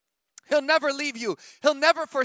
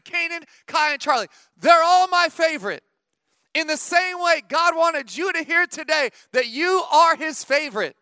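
louder, higher preaching drive; full-force preaching; 4 semitones higher, 4 dB louder than usual. Acted. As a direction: press it home to the church.

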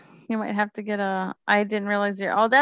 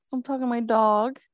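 ordinary talk

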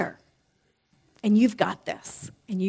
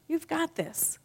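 higher and softer reassurance; soft inner cheerleader; 7 semitones higher, 7 dB quieter, slower than usual. Pretend. Speaker voicing the higher self again, softer and high. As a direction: say it gently, up high. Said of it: "The inner cheerleader says different things".

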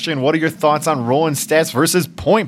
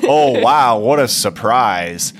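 mock surprise; slower than usual. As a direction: feign amazement at the utterly predictable. Mock reaction to predictable rogue player.

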